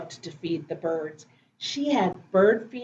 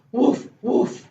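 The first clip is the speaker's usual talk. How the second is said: short imitation woofs; quick, playful mock barking; puppy bark; 3 semitones higher than usual. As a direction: bark like a little dog. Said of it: "What did Biscuit say?".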